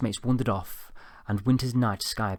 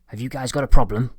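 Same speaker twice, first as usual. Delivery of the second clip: loud, higher-pitched challenge to the class; rough squeaky teacher; 4 semitones higher, 12 dB louder than usual. A narrator's reading. New lecturer confronts laughing students, loud challenge. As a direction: snap it loudly at the laughing students. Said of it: "he said in his voice".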